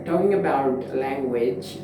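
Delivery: ordinary talk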